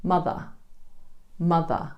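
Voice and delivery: "Mother" ending in a soft schwa, no R. Shown as a demonstration. Teacher models "Mother" for learners, schwa ending. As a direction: say "Mother" with a relaxed uh at the end, no R. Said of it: "a simple, relaxed schwa E sound".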